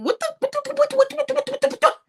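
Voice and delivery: high stuttering what what what; high, rapid stunned whats; stammering disbelief. Retold or imitated. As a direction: sputter in shock. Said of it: "I was just sitting there going".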